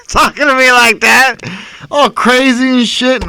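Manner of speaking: high, loud, slow fake alarm; mock panic. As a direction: shriek for help in a hammed-up panic. Mock-panicked joke about the patient.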